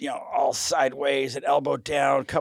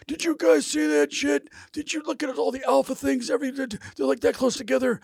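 high, amazed director gushing; excited rave. Retold or imitated. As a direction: babble it out thrilled, way up in pitch. Cameron raving about the shooting.

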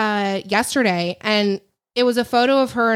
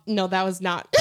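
normal talking voice

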